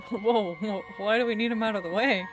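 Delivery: ordinary talk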